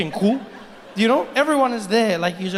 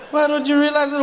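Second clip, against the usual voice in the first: ordinary talk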